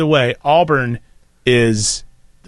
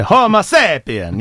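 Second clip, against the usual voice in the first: Homo sapien slow and high with Italian lilt; playful Italian accent; mock Italian Homo sapien; 4 semitones higher, slower than usual. Shown as a demonstration. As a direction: sing out Homo sapien like a Godfather. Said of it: "you have to do that".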